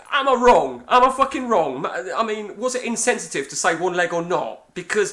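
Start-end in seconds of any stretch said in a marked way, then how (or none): 0.1-1.8 higher, louder indignant challenge; indignant self-defence. Real, not acted. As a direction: demand agreement, exasperated. Defends his outburst to listeners.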